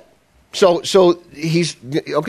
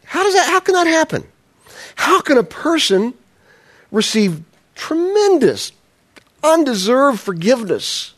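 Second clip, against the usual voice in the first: high, breaking cry of disbelief; incredulous; 11 semitones higher than usual. Real, not acted. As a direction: ask as if it hurts to imagine. Preacher baffled by unforgiveness.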